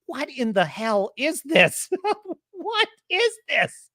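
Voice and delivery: slow, rising disbelief; a high, slow What is this; incredulous. Retold or imitated. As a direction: stretch out each word in disbelief. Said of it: "And I'm like".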